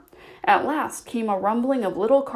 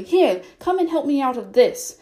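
demanding; 4 semitones higher, 5 dB louder than usual. Acted. Rabbit stuck demanding help.

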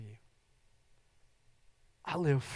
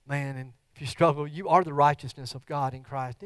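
usual speaking voice

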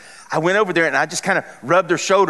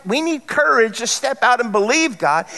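ordinary talk, nothing marked

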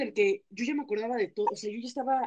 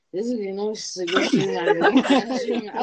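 loud, slow, slurred and laughing; imitating drunk voice; 7 dB louder than usual. Answering mom on the phone, mock drunk and giggly. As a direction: play back how tipsy you sounded, giggling. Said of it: "y ya la voz se me escuchaba".

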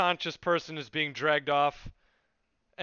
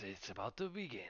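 quiet ominous line; 5 semitones lower, 16 dB quieter, faster than usual. Acted. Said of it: "leans forward and says".

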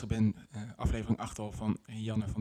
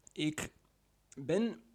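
ordinary delivery

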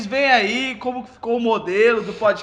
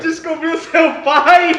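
slow, high dramatic yell; mock dramatic shout; 7 semitones higher, 10 dB louder, much slower than usual. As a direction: shout it like a soap opera reveal. Mock horror at father's reveal.

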